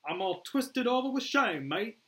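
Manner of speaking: slow, high Australian sarcasm; mocking Australian accent. Boomer mocks Flag's rebuke, slow Australian accent. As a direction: drawl it loudly as an Australian.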